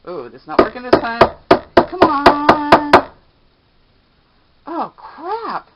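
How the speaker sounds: loud, high, straining against a lid; grunting effort on a stuck jar; opening a stuck jar. Real, not acted. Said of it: "I can't open the jar".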